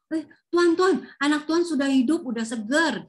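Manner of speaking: high and loud, tumbling out with joy; excited servant's news. Retold or imitated. Servant meets master on the road, excited high loud shout. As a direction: burst in shouting the good news.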